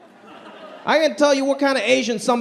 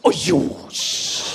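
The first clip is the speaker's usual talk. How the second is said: disgusted shush; 2 semitones lower, much slower than usual. Retold or imitated. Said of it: "they're just like".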